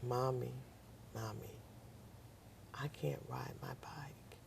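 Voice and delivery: put-on soft, whispered, tearful little girl's plea; crying child. Daughter crying to her mother, whispered and tearful. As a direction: voice the sick daughter whimpering quietly. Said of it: "and she's crying".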